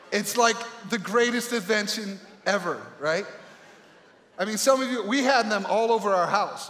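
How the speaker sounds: ordinary delivery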